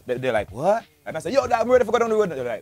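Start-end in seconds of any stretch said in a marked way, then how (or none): 0.5-0.8 puzzled foreign friend. Retold or imitated. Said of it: "me always have to repeat". 1.3-2.3 high, loud Jamaican patois retelling; re-enacting himself in loud, excited patois; natural patois. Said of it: "me always have to repeat".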